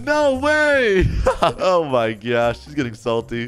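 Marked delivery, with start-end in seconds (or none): 0.1-1.1 high, drawn-out protest; exaggerated squeal of disbelief; mock outraged disbelief. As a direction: imitate a player who just got caught. Acting out found player's reaction.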